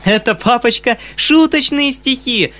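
put-on very high, loud, gleeful girl; squealing childish joy; delighted outcry. A narrator's reading. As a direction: cry out, thrilled with the prank. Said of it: "вскричала она, ужасно радуясь".

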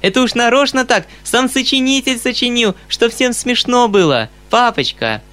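high, excited explanation to papa; gleeful girl. A narrator's reading. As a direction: bubble over with joy at the prank. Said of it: "ужасно радуясь своей детской затее".

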